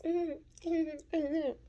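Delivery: high mm-mm of disapproval; high scolding hum; disapproving hum. Real, not acted. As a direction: shake your head with a hum. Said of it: "disapproving hum".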